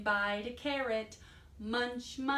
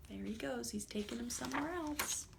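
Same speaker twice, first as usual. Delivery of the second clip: hushed aside; 3 semitones higher, 6 dB quieter, faster than usual. Real, not acted. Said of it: "storyteller's hushed aside".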